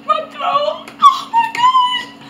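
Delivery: high, broken crying voice. Real, not acted. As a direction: cry out through the burn. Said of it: "I'm crying too now".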